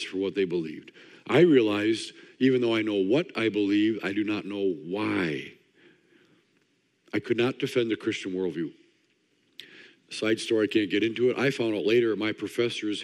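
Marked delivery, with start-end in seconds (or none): none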